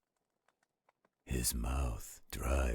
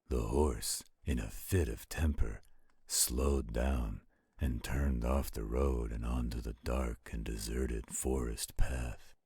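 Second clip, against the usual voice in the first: ordinary delivery, nothing marked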